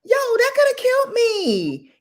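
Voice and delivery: very high, loud outcry; shrieked amused indignation; indignant disbelief. Real, not acted. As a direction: squeal it in outrage. Outraged at being fed vapor rub.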